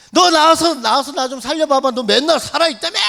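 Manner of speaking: loud, high shouting at God; retold desperate yelling. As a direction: yell it out, demanding that God step in. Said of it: "막 소리 질러서".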